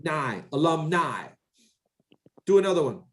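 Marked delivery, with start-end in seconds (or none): 0.0-1.3 "Nigh" alone, then "Alumni" ending the same; ending in eye sound. Shown as a demonstration. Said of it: "add the word I to the end".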